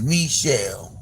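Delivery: Michelle said "mee-SHELL"; French-style first syllable. Retold or imitated. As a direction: open Michelle with a clear "mee". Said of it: "That ain't her name".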